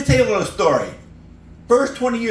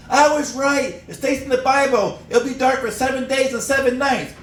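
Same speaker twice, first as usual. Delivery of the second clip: yelling; 8 semitones higher than usual. Retold or imitated. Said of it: "he yells out".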